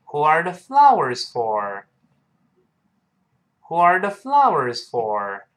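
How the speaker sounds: model reading. Shown as a demonstration. Teacher shows the plural form to students, slow model reading. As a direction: read the plural question slowly and fully. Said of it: "我们应该说".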